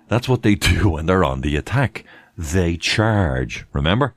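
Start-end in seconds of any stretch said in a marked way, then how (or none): none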